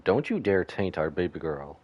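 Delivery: ordinary delivery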